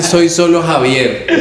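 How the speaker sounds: put-on slow, laughing Indian accent in Spanish. Telling friends about the scam call, laughing mock Indian accent. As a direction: say it slowly through laughter like a scammer. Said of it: "acento de man de la India".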